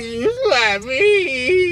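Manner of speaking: slow whimpering complaint; mock sob after a slap; whiny fake cry. Complaining after being slapped.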